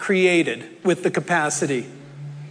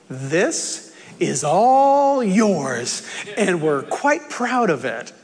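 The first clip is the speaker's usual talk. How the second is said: grand, drawn-out presentation; Jesus; slower than usual. Acted. Jesus showing the rich man his shed, grandly proud. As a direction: stretch it proudly, like unveiling a prize. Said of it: "and Jesus says".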